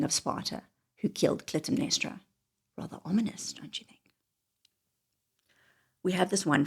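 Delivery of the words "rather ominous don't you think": soft unvoiced whisper; hushed ominous aside. Real, not acted. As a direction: whisper it like a dark secret. Spooky aside to viewers.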